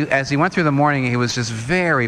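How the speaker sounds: ordinary delivery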